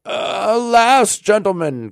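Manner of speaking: high, loud cry of dismay. A narrator's reading. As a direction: protest in dismay, pitched well up. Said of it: "somewhat dismayed".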